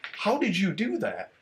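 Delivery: retold high, drawn-out disbelief; mock disbelief. Medic questions a Marine about a blistered hand, disbelieving. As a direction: stretch it out high, as if astonished.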